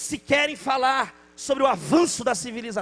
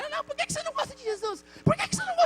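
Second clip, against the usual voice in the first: put-on much higher, quick, pleading child voice; insistent little girl; much faster than usual. Girl at the neighbor's door, high insistent child voice. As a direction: press him like a stubborn kid. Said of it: "a menina ficou indignada".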